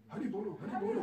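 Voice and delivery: high, loud joyful chant with a laugh; ecstatic cry. Acted. Nityananda forgives the brothers, ecstatic high loud cry. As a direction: call it out in pure rapture. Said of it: "Er war ekstatisch".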